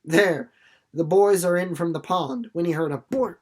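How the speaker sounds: ordinary delivery, nothing marked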